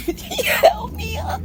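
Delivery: high, squeaky words through laughter; real laughter squeezing the voice high; laughing. Real, not acted. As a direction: crack up while drawing out the words. Cracks up repeating her own line.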